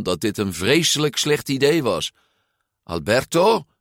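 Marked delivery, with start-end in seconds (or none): 2.8-3.7 raised, questioning objection; protesting. A narrator's reading. Luca objects to his friend's fight, protesting. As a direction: protest with the name alone. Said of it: "protesteerde hij".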